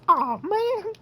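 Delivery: very high, loud character squeal; put-on Hannah voice. Hannah coming up to the narrator's victim, put-on high voice. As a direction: pitch it way up, hammed-up.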